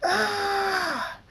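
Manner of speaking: very high, drawn-out scream; pained scream. A narrator's reading. Draconix struck as lightning crackles, screaming. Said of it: "Draconix screams".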